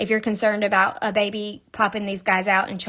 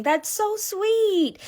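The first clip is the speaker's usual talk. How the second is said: cooing; 10 semitones higher, much slower than usual. Real, not acted. Cooing over the little toy.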